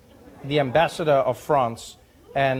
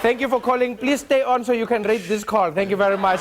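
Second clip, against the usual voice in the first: higher, lightly voiced operator script; operator sign-off; 6 semitones higher, 4 dB louder than usual. Operator ending the call, mock officious. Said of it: "They'd be like".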